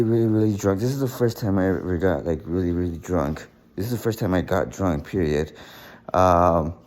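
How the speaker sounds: ordinary talk, nothing marked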